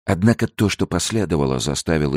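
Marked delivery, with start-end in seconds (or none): none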